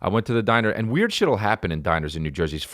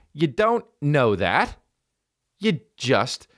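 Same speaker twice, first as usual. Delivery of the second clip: high, drawn-out retort; exasperated protest; 7 semitones higher, slower than usual. Retold or imitated. Comic challenging opener's guess about Kevin Hart, high exasperation. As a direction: act out mock outrage, stretching the words. Retold argument with his opener.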